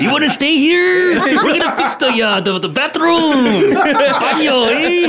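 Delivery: high, hammed-up Mexican dad voice; exaggerated Mexican lilt with drawn-out endings; mock Mexican accent. Playing her boyfriend's Mexican dad, high exaggerated accent. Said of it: "that sounds too authentic".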